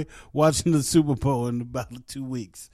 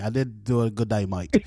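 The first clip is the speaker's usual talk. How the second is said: ordinary talk, nothing marked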